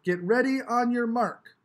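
high, loud starting call; starter calling. A narrator's reading. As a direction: shout the start to the crowd. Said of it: "Bump called".

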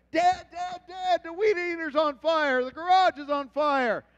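put-on high, loud panicked kid's yell; yelling child. Daughter yelling to her dad from the window, panicked. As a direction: shout for Dad like a frightened child. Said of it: "I hear Lori yelling from the window".

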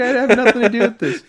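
high, loud protest through laughter; laughing protest. Retold or imitated. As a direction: retell your innocence, cracking up as you go. Laughs while retelling his protest.

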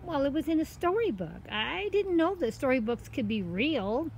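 put-on high girl's voice explaining herself; innocent story girl. Girl explains her fright to the cat, innocent. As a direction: pitch it high, earnest and innocent.

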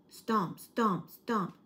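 loud, weighty stomping footsteps; heavy stomps. A narrator's reading. Narrator booming footsteps as something approaches, loud. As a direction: boom out each stomp like a giant's step.

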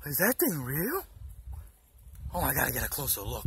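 hushed, low, fast leering mutter; leering aside. Retold or imitated. To himself while eyeing a girl, hushed leering mutter. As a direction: mutter it to yourself while ogling.

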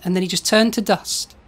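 normal talking voice

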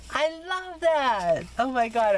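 high, slow and drawn-out love; delighted. Real, not acted. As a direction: gush over the saying, stretching it out. Delighted by card saying.

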